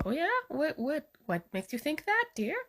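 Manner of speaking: pretending it's news to her; feigned innocence. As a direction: play dumb, sugary and surprised. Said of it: "I'm trying to act like this is new".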